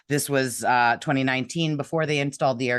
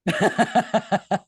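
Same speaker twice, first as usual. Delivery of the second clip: high burst of giggles; laughing; 6 semitones higher than usual. Real, not acted. Actress reacting to host's joke, high laughter.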